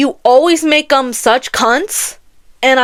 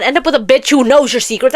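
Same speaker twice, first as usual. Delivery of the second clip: ordinary delivery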